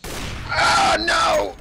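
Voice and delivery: sudden high cry of alarm; alarmed shout. Real, not acted. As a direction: yell it in shock. Sudden danger in the game.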